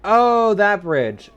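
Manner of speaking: sudden loud, high "oh, that bridge"; light up as it clicks; surprised realization. Real, not acted.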